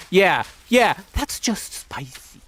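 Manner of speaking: mock the patronizing reply, breathy and high; sneering mock dismissal. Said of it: "so fucking patronizing".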